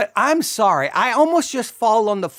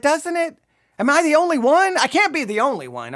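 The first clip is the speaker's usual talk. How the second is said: incredulous appeal; 7 semitones higher than usual. Real, not acted. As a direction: appeal to the listeners in disbelief. Pleads for agreement in disbelief.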